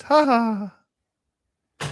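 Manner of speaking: high, sustained ha-ha call; mimicking a distant woman's high call. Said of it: "we hear a woman's voice".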